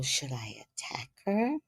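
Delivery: soft, hushed question to spirit; quiet retold inner question; secretly asking. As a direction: ask it under your breath. Repeats the private question to spirit.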